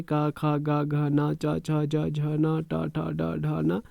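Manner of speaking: का, खा, गा each ending in a clear आ; reciting with the आ sound. Shown as a demonstration. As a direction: chant the letters, stress the आ. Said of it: "का से लेके हा तक अगर हम पढ़ते जाएंगे". (हर वर्ण में आ की आवाज़ साफ़ बोलना)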